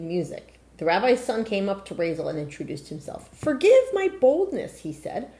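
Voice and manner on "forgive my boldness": put-on courtly young man. Rabbi's son courting Razel.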